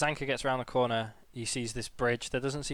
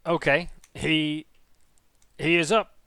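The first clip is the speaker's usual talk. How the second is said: kobold guard voice; 3 semitones higher, 6 dB louder, slower than usual. Acted.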